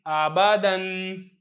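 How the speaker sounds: slow "आबादन", final n held long; hamza with tanween. Shown as a demonstration. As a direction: recite "आबादन" with a drawn-out nasal ending. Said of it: "अभी पढ़ के बताया है".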